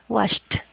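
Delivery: "Washed" ending in a T sound. Shown as a demonstration. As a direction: model "Washed" as one syllable, final T released. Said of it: "esa E D va a sonar como una T". ("Washed" con T final)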